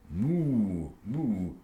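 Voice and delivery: soft lowing moo; low like a cow, softly. Acted. Father imitating a cow, soft moo.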